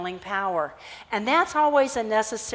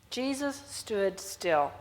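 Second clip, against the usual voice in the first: slow, softer hushed repeat; slower and softer; 6 dB quieter, much slower than usual. Shown as a demonstration. Preacher repeating the verse slowly, softer and hushed. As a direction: draw it out even quieter. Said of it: "is interesting".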